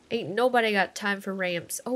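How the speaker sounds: normal talking voice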